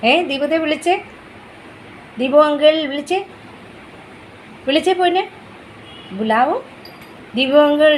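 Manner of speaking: urging the little one to call Deepu uncle. Real, not acted. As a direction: call out to get his attention. Urging child to call passing neighbour.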